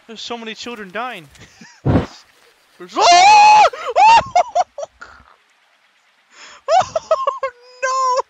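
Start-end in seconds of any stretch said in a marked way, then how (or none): 3.0-5.3 very high, very loud shriek breaking into a laugh; scream, then laugh at yourself; terrified scream. Real, not acted. Gamer startled by a jump scare, screaming.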